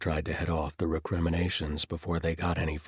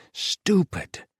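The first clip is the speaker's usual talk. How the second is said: high, loud self-reproach; loud outburst; 7 dB louder than usual. Acted. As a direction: snap at yourself. Self-reproach, second take shouted.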